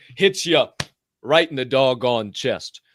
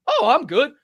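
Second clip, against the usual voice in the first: mock breezy confidence; 12 semitones higher, 7 dB louder than usual. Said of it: "I was like".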